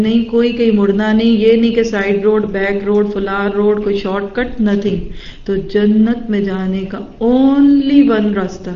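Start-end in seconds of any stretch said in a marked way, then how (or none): 5.4-8.7 slow emphasis. Shown as a demonstration. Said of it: "teaching the single path".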